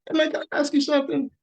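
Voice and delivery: high, quick, light voice; breezy, disarming question; quick high casual voice asking the skinhead a question; high friendly ask. Retold or imitated. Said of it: "I just was like".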